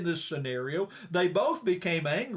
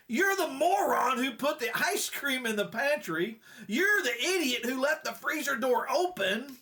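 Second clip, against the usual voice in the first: high-pitched outraged wife; mock yelling back; 7 semitones higher than usual. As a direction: hurl accusations in a put-on high voice. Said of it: "she yelled back".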